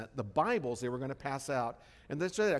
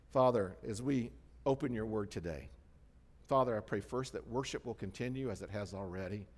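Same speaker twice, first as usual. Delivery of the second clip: lower, softer praying voice; prayer; 6 semitones lower, 6 dB quieter than usual. Real, not acted. Pastor praying over the congregation before the sermon, low and soft. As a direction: settle into a quiet prayer.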